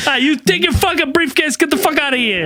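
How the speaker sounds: high, laughing tough-guy yell; hammed-up Boston anger; laughing mock rage. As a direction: chase them out, half laughing. Kicking agents out, mock.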